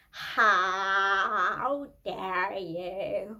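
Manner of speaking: drawn-out, very slow wailing protest; outraged. Acted. Singer outraged at the auto-tune question, wailing slowly. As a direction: stretch it out in mock offense.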